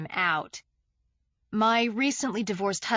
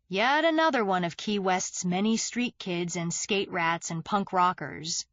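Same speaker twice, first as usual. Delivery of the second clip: usual speaking voice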